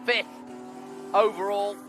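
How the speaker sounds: measured live race play-by-play; motorsport commentator. Real, not acted. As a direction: call the positions over the track.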